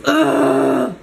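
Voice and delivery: disgusted growled "ugh"; imitated growl. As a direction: mimic the maid's exasperated growl. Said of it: "growled at me".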